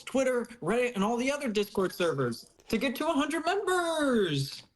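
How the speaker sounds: high, escalating rally cry; hammed-up recruiting enthusiasm; mock excitement. Acting out owner's recruitment message.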